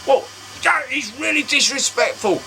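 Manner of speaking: mock offended salesman. Salesman calls the remark disrespectful, mock prim. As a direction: play the affronted dealer.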